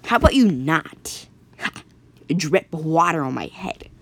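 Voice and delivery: slow, deliberate complaint with a laugh breaking in; annoyed hero voice. Acted. Jonathan tells the dripping water off, slow and annoyed. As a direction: talk down to the dripping water, slowly.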